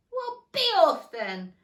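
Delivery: loud, slow sulky dismissal. A narrator's reading. Pigeon sending Alice off, sulky. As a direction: dismiss her with a sulk. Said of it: "in a sulky tone".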